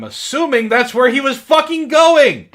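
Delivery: loud, high, fed-up rant; reading bad fan fiction aloud, suddenly loud and fed up; exasperated outburst. Real, not acted. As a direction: blow up at how dumb the story is.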